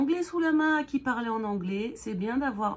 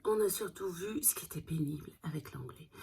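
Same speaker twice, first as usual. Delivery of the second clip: softer whispered aside; hushed confiding complaint; 4 semitones lower, 8 dB quieter than usual. Real, not acted. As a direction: whisper it as a grumble.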